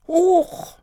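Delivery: very high startled yelp; mock startled huch. Child imitating a startled Santa, squeaky yelp. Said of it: "machte Luca den Weihnachtsmann nach".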